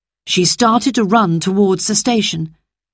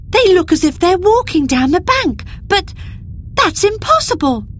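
amazed; 14 semitones higher than usual. A narrator's reading. Amazed child voiced by narrator.

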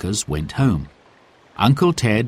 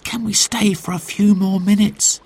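put-on lightly raised child voice pleading; child's voice; 11 semitones higher than usual. Boy asking his uncle to stay longer, raised child voice. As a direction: ask like a hopeful boy. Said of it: "he said".

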